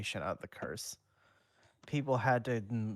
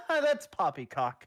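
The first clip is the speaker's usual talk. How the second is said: higher and louder, a hammed-up dismissive snort of a line; mock scoffing townsfolk; 7 semitones higher, 5 dB louder than usual. Mocking people who refuse to believe Wes, scoffing. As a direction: play a stuffy doubter waving it off.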